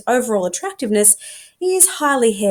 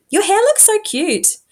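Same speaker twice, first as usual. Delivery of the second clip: gushing; 12 semitones higher, 4 dB louder than usual. Acted. Brandy gushing compliment.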